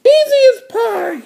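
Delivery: very high, cocky little-sister voice. Acted. Little sister showing off to her brother, very high voice. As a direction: sing it out high like a cartoon kid. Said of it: "said D W".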